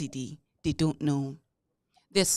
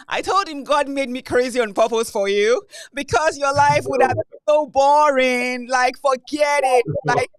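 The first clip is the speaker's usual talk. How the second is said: loud, high, playful boast; excited teasing; 7 semitones higher, 11 dB louder than usual. Real, not acted. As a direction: tease him loudly that he needs you. Laughing boast to husband.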